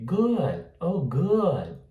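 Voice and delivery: high "good" with barely any D; unreleased D. Shown as a demonstration. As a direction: stop the tongue on the D of "good". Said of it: "Quase você não vê o D". ("good" com D quase imperceptível)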